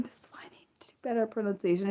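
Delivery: embarrassed whisper, fast and soft; whispered to self. Real, not acted. Streamer scolding herself after a mispronunciation, whispered. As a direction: mutter it under your breath.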